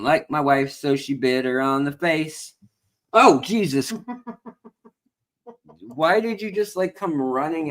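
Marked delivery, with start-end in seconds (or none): 3.1-7.7 high, startled "Oh, Jesus" with a laugh; startled yelp. Real, not acted. Man startled as wife brings the biting cat, high yelp. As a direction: jump back in surprise.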